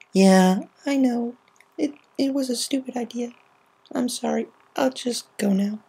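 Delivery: high, weak dejected voice. Acted. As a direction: apologise and back off. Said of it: "grinned weakly".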